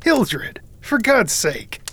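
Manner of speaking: high, alarmed cry, slowed on the end. A narrator's reading. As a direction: cry out his name in alarm.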